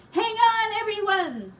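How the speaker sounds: high, loud shout. Acted. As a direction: yell over the struggle. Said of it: "shouted Sorrel".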